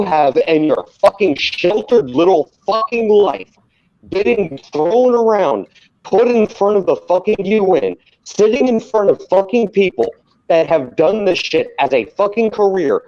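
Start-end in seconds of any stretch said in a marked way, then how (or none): none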